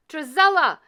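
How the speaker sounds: loud high calling out for the daughters; calling out loudly. A narrator's reading. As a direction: shout the names up the stairs. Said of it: "cried the stepmother".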